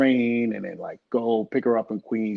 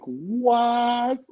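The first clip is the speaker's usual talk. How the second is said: long, high-pitched stunned question; mock floored reaction, voice way up; retold shock; 13 semitones higher than usual. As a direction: stretch it out in disbelief. Retells stunned reaction to mother's challenge.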